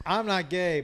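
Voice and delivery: ordinary talk, nothing marked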